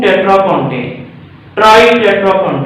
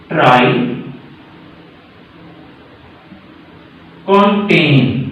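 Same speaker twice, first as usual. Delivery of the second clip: drawn-out name; 4 semitones lower, much slower than usual. Shown as a demonstration. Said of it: "thirty को बोलते हैं".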